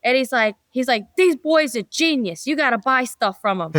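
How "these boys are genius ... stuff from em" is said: put-on New York accent. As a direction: rave about them in a New York accent. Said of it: "your New York accent was pretty good".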